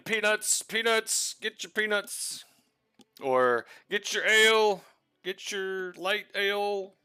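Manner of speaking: high ballpark vendor hawking peanuts; vendor calling out. Acted. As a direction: call out like a stadium peanut seller.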